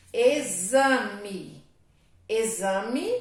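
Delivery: Exame loud, x said as z. Shown as a demonstration. Teacher saying Exame to show x sounds like z. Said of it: "O X tem som de Z".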